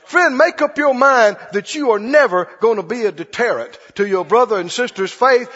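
normal talking voice

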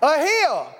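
mock-cocky scoff. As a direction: brush it off with confidence. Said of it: "he goes".

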